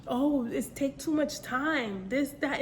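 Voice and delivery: ordinary delivery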